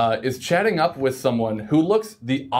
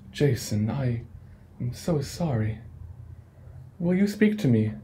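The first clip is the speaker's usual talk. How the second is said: serene sloth. Acted. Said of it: "and says".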